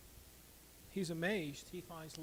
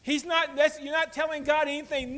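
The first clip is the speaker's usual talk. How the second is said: loud, higher sermon push; preaching; 9 semitones higher, 10 dB louder than usual. Acted. Pastor correcting the congregation's idea of confession, loud preaching. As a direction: hammer it home.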